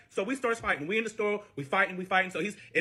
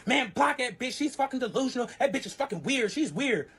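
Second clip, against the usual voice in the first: worked-up retold rant, higher pitched; heated; 7 semitones higher than usual. Boyfriend venting about a woman's comment, heated. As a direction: imitate him venting, pitched up. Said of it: "So he's heated".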